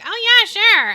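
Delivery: mocking a careless yes to parenthood; mock breezy agreement. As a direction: toss it off like it's nothing. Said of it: "instead of just being like".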